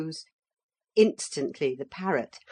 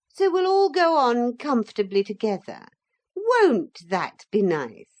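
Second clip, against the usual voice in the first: smug Pretenderette; 8 semitones higher, 6 dB louder than usual. A narrator's reading. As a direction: savour the threat as if it were kindness.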